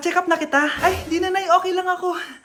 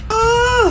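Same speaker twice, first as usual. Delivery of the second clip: high, drawn-out labour groan; straining cry; 6 semitones higher, 3 dB louder than usual. Acted. Mother pushing during birth, straining.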